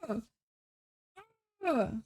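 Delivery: exasperated ugh, short and pushed; frustrated groan. Real, not acted. Streamer groaning at the sale prices, exasperated. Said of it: "Oh, I'm angry".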